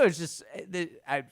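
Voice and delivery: normal talking voice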